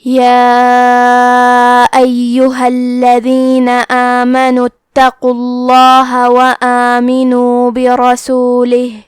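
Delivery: slow, melodic Quran chant; measured Arabic recitation, every phrase drawn out; tajweed recitation. Acted. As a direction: recite the full passage in a long, sung tilawat. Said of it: "اب آپ ان آیتوں کی تلاوت تجوید کے ساتھ سماعت فرمائیں".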